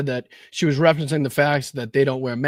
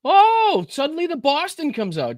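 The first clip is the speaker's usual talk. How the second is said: high, delighted gotcha; mocking gotcha at the accent; mock surprise; 13 semitones higher than usual. Real, not acted. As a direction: pounce on the slip with glee. Catches the guest's accent.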